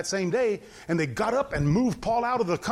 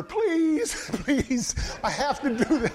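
higher, put-on begging voice with a laugh; pleading captain; 5 semitones higher, slower than usual. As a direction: plead timidly with the prophet. Third captain pleading humbly.

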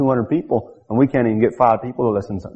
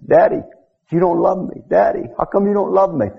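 put-on a touch of the boy's plea, a little higher; retold child's plea; 6 semitones higher than usual. Preacher recalling the boy calling to his daddy, mildly pleading. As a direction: lightly echo the child's cry. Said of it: "We heard it over and over again".

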